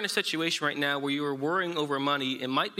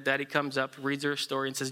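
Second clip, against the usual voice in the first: usual speaking voice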